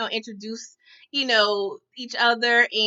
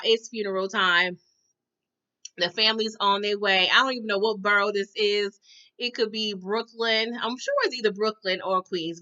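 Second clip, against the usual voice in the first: ordinary talk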